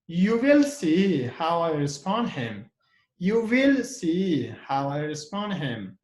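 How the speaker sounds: "will" drawn out and pushed harder; stress on WILL. Shown as a demonstration. Teacher showing how to pronounce will, with stress on "will". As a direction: lean firmly on "will". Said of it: "pronounce will, it should be with stress".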